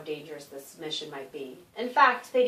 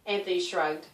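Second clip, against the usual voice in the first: plain reading voice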